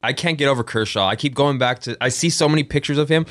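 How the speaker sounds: ordinary talk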